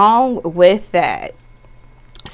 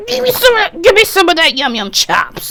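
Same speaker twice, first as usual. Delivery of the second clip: higher, louder hammed-up craving; playful craving; 10 semitones higher, 5 dB louder than usual. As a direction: ham up the greedy want.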